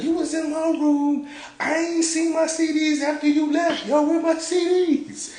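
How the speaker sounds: loud falsetto mock accusation; high-pitched imitation. Homeboy accusing Wayne in his room, high-pitched. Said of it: "high pitch".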